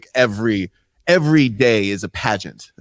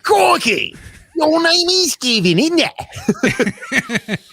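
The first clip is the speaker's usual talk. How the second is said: very high, hammed-up Aussie squawk; mock Australian accent; much slower than usual. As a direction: echo a ridiculous Australian accent at high pitch. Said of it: "a Australian".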